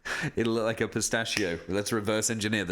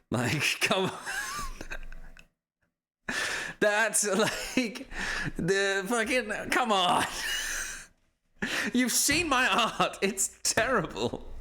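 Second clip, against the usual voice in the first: high-pitched, giggly exasperation; helpless laughing exasperation; laughing protest; 9 semitones higher, slower than usual. Real, not acted. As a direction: protest through laughter, voice climbing. Laughing in protest at own drawing.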